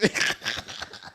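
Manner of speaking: real wheezy, voiceless laughing fit; helpless laughter. Real, not acted. Storyteller losing it at his own impression, helpless laughter. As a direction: crack up right after the mock cry. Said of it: "I can't handle the laughing".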